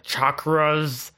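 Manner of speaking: "chakras" said slowly with a heavy hard R; mock American R in chakras. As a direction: imitate "chakras" with the R overdone and drawn out. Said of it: "it sounds like that".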